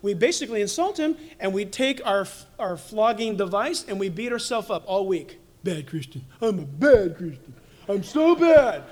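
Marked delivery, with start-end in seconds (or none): 5.6-8.8 whiny self-pity. Acted.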